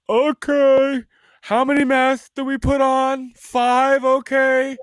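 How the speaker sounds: very high, loud, gullible voice; mock compliant public. Mimicking people obeying mask rules, high eager mock compliance. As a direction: squeal it like an over-eager follower.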